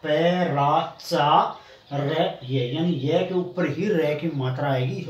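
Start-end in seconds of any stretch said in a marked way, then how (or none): 0.0-2.7 पै रा चा रे ये very slowly, each piece apart; teacher's drawn-out breakdown of पै रा चा रे ये; syllable-by-syllable demo. Shown as a demonstration. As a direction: pause after each sound of पै रा चा रे ये. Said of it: "ये रे के ऊपर पढ़ी जाएगी".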